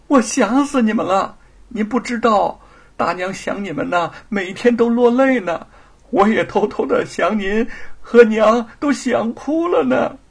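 put-on high, eager little boy; a child pouring out how he missed them; child's voice. 孩子向父亲倾诉. (孩子高声撒娇倾诉)

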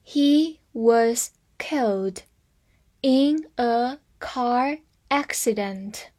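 slow, in two parts; slow reading. Shown as a demonstration. Teacher reading slowly to students, in chunks. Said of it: "慢慢读。".

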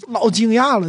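ordinary talk, nothing marked

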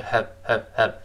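"have" reduced to a short, soft schwa "həv"; weak form. Shown as a demonstration. Teacher shows learners the weakened "have", short soft "həv". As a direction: toss off "have" lightly as "huhv". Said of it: "在这儿弱化".

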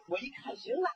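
ordinary delivery

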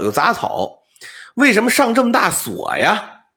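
ordinary delivery

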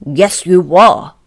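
loud, testy retort; snapping. Acted. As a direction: snap back rudely and loudly. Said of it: "snapped Mister Uppity".

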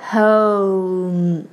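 HOME low and very slow, o sliding into a hummed m; diphthong demo. Shown as a demonstration. Teacher shows the double vowel, diphthong demo. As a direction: draw out the glide in HOME. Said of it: "又是个双元音o".

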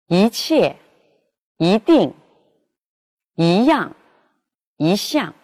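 一 said with a rising second tone before the falling syllable; rising 一 before each falling tone; yi rising before fourth tone. Shown as a demonstration. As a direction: let 一 rise, as in 一切 and 一定. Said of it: "在去声前变阳平".

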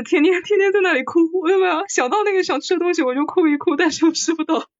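hammed-up whimper over food she can't have; mock whiny complaint. Complaining to her co-host about lockdown food, mock whiny.

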